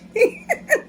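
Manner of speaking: shrill burst of laughter; high squealing laugh. Real, not acted. Laughing at her own story.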